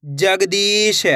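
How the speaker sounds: Jagdish very slow, jag-deee-sh; sounded out slowly. Shown as a demonstration. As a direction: stretch every part of Jagdish. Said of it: "एक अक्षर का उच्चारण करके".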